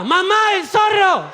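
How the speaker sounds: put-on high, slow kid's shout; child shouting. Little boy pointing in the pharmacy, high excited shout. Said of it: "lo apunta y grita".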